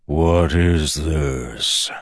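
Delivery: a shocked evil god demands; demanding dark god. Acted. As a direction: stretch the question out with dread. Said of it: "Ruin's voice demanded".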